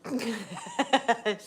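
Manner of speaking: a real burst of laughter; short laugh. Real, not acted. Owner laughing as her dog dodges the camera.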